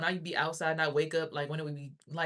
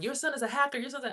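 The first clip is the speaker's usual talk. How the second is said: mock accusers; 8 semitones higher, faster than usual. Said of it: "people be looking at my son like".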